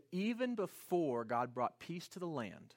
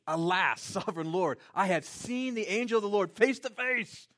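louder, higher cry of alarm; alarmed exclamation; 5 semitones higher, 7 dB louder than usual. Acted. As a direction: cry out as if you just saw an angel. Gideon exclaims in terror.

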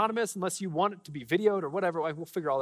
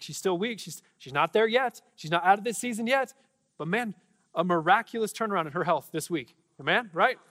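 higher, louder, fervent preaching; preaching at full force; 4 semitones higher, 4 dB louder than usual. Acted. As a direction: build to a proclaiming peak. Preaching builds on healing story.

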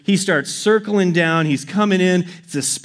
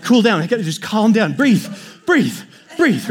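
shaking with nerves; 4 semitones higher than usual. Retold or imitated. Shaking hunter telling himself to calm down, mock panic. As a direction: urge yourself to breathe, pitched up and frantic. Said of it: "I'm just shaking".

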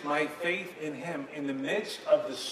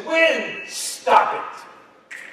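loud, high shouted command; commanding rebuke; 12 semitones higher, 7 dB louder, much slower than usual. Acted. Jesus in the boat rebuking the wind, commanding shout. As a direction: rebuke it with two forceful bursts.

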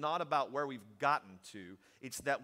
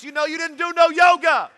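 loud, rising preacher's call-out; fiery preaching; 8 semitones higher, 14 dB louder than usual. Acted. Preacher scolding posers in the pews, loud fiery preaching. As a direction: hammer the point home to the congregation.

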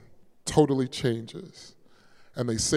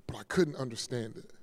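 barely voiced, much softer; whispered; 3 semitones lower, 10 dB quieter than usual. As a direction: let it fall to a whisper of grief. Grieving friend retold quietly.

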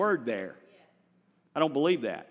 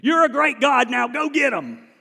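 mock demand; 7 semitones higher, 7 dB louder than usual. Preacher paraphrasing David's demand.

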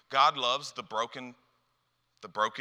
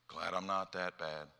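smug aside; 5 semitones lower, 6 dB quieter than usual. Acted. Smug aside about someone struggling.

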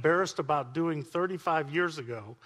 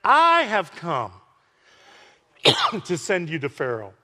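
angel proclaiming; 6 dB louder than usual. Acted. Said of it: "the angel of the Lord said".

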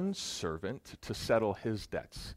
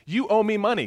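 high, loud furious demand; angry demand; 10 semitones higher, 11 dB louder, faster than usual. Acted. Servant choking his debtor, shouting an angry demand. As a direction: snarl it while choking him.

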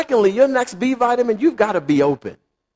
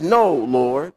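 firm refusal; 7 semitones lower, much slower than usual. Retold or imitated. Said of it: "He says".

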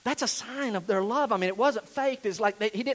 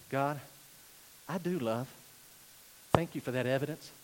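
low, soft, unhurried prayer; quiet confession; 6 semitones lower, 6 dB quieter than usual. Shown as a demonstration. Preacher praying thanks to God, quietly. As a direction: pray it gently. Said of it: "saying this confession to God".

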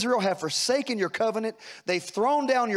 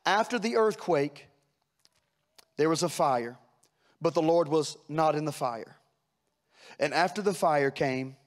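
usual speaking voice

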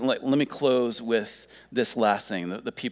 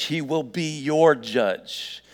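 slow, higher, stress on "your"; emphatic warning; 5 semitones higher, 4 dB louder, slower than usual. Real, not acted. Preacher confronting listeners with judgment, slow emphatic warning. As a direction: press "your judge" home, drawn out.

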